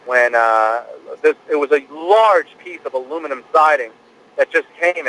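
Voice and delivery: ordinary talk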